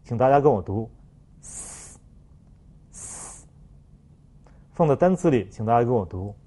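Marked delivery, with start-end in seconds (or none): 1.4-3.5 s as a long voiceless hiss, no vowel; just the friction of s, held; hissed fricative. Shown as a demonstration. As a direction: teeth closed, push air through the gaps on s. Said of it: "气流从牙齿的缝隙里面摩擦出来".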